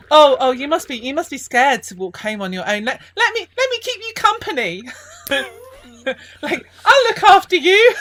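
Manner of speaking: high, loud, mock-gallant lad; playfully overeager offer; cheeky lad. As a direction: lad teasingly offering to walk the old woman home. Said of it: "he goes".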